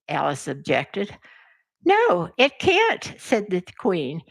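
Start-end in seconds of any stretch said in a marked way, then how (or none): 1.8-3.1 high contradiction. Acted. Queen flatly contradicting Alice.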